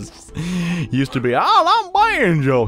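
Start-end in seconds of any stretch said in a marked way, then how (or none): 1.3-2.7 high, loud, slow hillbilly drawl. Retold or imitated. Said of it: "He used to be one hundred percent hick".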